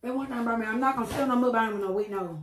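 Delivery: ordinary talk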